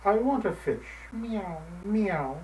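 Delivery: cat purring for a fish. Acted. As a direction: purr it out like a cat. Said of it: "she purred again".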